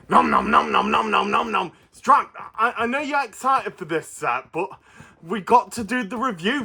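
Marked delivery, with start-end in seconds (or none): 0.1-1.7 munching creature voice. Acted. Puppet Stripe munching again.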